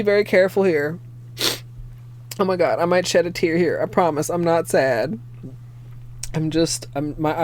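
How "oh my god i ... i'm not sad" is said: ordinary talk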